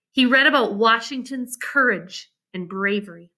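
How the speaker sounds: ordinary delivery, nothing marked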